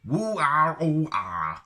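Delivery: loud mock Wookiee call, high then dropping. Shown as a demonstration.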